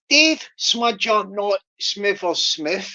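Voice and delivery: slow, careful tongue twister, each word spaced out; slowly. Shown as a demonstration. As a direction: take it slow so every word lands. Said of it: "I'll do it slowly".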